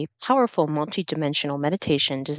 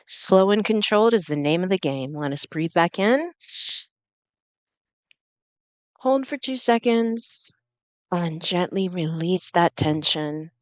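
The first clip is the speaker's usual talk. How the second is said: guided breathing; 3 semitones higher than usual. Acted. Leads slow breathing exercise.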